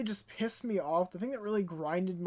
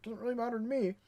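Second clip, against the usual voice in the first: softer, shrugging imitation of a company; couldn't-care-less mimicry; mock indifference; 6 dB quieter than usual. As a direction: play them smugly not caring. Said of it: "They're just like".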